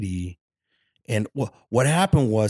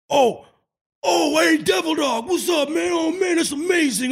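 much higher, loud eager greeting, gushing; sudden friendly excitement; 3 dB louder than usual. Retold or imitated. Marine who spots a fellow Marine's tattoo, suddenly fawning and shouting. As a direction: flip from hostile to fawning buddy. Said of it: "and he literally was like".

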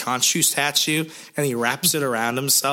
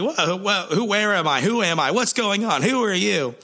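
higher, bewildered mock panic; confused revived man; 8 semitones higher than usual. Revived hero asking the woman who he is, mock confused. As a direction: wake up lost and flustered. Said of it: "he's like".

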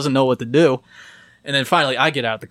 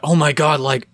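mock alarmed fussing, pitched up; alarmed concern; 5 semitones higher, 4 dB louder than usual. Friends find the drunk kid, imitated alarmed concern. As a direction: imitate worried friends, high and loud.

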